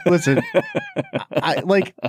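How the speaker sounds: real laughter bubbling through the talk; giggling through words. Real, not acted. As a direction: try to explain while fighting the giggles. Cracking up at an innuendo.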